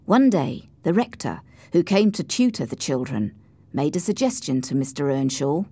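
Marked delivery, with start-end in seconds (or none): none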